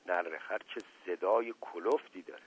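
low, hushed aside; very quiet voice. A narrator's reading. Fugitive on the roof commenting on his pursuer, very quietly. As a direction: keep it soft so nobody hears. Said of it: "با صدای خیلی آهسته گفت".

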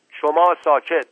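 loud, high curt order; sharp silencing. Acted. Uncle cutting off an interrupter, sharp command. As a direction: shut him up at once.

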